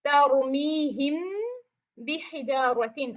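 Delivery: तरुमीहिम बिहिजारतिन drawn out slowly, the मीम before बा hummed with ghunna; Quran recitation demo. Shown as a demonstration. Teacher reciting the example slowly for students. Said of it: "हम उसकी example देख लेते हैं".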